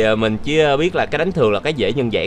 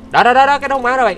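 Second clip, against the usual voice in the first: much higher, louder burst of "đó"; excited shout; 12 semitones higher, 6 dB louder than usual. Real, not acted. Gamer to viewers as the blood orbs fly in, excited shout. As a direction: point it out triumphantly. Lifesteal orbs finally appear.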